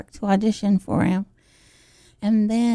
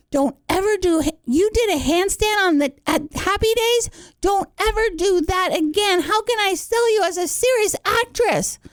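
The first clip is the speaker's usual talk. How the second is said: scolding agent; 8 semitones higher than usual. Retold or imitated.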